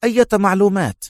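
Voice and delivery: high-pitched irritable exclamation; testy, high-pitched outcry; agitated cry. A narrator's reading. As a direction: burst out, nerves on edge. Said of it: "هتف رأفت في عصبية".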